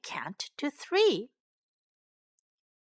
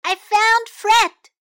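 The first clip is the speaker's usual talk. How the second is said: high, loud, slow announcement; excited cry; 10 semitones higher, 12 dB louder, slower than usual. A narrator's reading. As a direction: cry out the good news, drawing it out. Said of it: "cried Sam".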